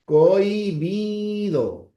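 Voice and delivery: Cohibido drawn out, the h silent, stress on bi; slow dictation. Shown as a demonstration. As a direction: stretch Cohibido slowly and firmly. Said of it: "sería así".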